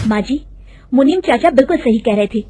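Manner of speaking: a touch of alarm at the fraud; urgent report. Acted. Daughter-in-law reveals the fraud to her mother-in-law, rising urgency.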